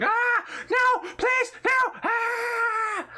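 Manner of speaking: high, loud screams and pleas; acted shrieks of agony, far above normal pitch; terrified screaming. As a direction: scream it like victims begging for their lives. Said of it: "people screaming".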